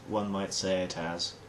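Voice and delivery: ordinary talk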